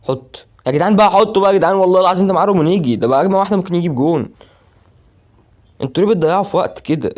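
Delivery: loud, high, urgent pleading at the players; excited shouting. Real, not acted. Gamer yelling at his players to score, excited. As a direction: yell at the team to shoot.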